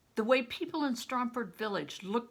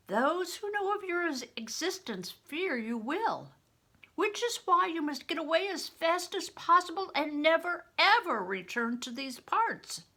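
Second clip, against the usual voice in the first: higher, louder widow pressing a grave warning; urgent old widow; 10 semitones higher, 4 dB louder than usual. Acted. Widow warning Crispin his blood means death, urgent and rising. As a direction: make him see the danger.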